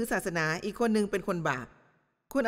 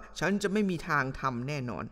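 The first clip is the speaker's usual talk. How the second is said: mock self-righteous vow; 7 semitones lower than usual. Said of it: "บอกว่า".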